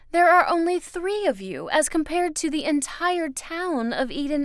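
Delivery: high, loud, emphatic woman's voice; Miss Foster, justifying herself. Acted. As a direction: lecture a child on why it must be so.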